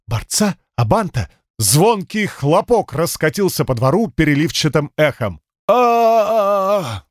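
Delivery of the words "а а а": crowd yell. Acted.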